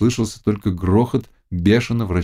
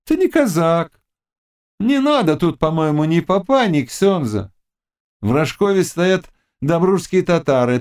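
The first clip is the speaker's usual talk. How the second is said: high-pitched, scornful witch; witch Gorpyna, scolding; 11 semitones higher than usual. A narrator's reading. Witch talking the Cossack out of a wedding, scornful. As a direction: needle him in a high witch voice.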